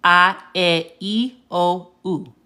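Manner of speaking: "A, E, I, O, U" sounded ah, eh, ee, oh, oo; Spanish vowel sounds. Shown as a demonstration. Teacher repeats the five vowel sounds, pure Spanish A, E, I, O, U. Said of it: "they always sound the same way".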